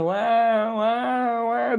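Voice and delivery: high mock newborn wailing wah; imitated baby cry. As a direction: imitate a crying baby, lightly. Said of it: "They start crying so loudly".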